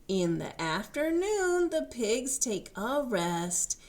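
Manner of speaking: animated read-aloud voice; reading to children. A narrator's reading. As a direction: read it out bright and sing-song for little ones. Reads picture book aloud.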